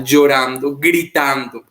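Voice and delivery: normal talking voice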